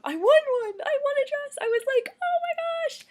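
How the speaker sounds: high, loud squeal of a winner; excited scream. Retold or imitated. Winner reliving the dress win in line, screaming with excitement. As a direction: re-enact an ecstatic, high-pitched scream. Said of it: "literally screamed out loud in line".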